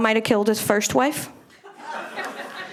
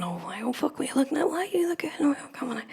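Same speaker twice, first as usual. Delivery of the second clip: hushed, much softer, straining into a tight dress; hushed struggling; 3 semitones higher, 8 dB quieter, faster than usual. Retold or imitated. Sister in the changing room, wrestling a dress on, hushed.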